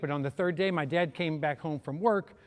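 usual speaking voice

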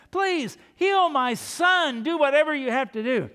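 high, loud begging for a dying son; desperate plea. Acted. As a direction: plead like a father out of time. Father begging Jesus for son.

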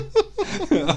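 a sudden high burst of laughter. Real, not acted. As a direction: laugh at your own punchline. Laughing at own story. (yüksek perdeden kahkaha)

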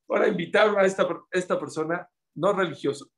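usual speaking voice

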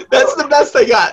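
ordinary talk

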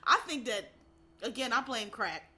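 ordinary talk

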